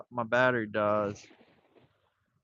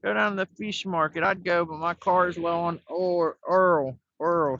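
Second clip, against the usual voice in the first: higher grandma voice, oil said "Earl"; imitating grandma; 5 semitones higher than usual. Grandson imitating grandma's errand request in a higher voice. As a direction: play grandma sending him to market. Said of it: "She'd be like".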